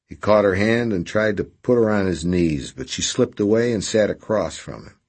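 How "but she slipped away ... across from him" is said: ordinary delivery, nothing marked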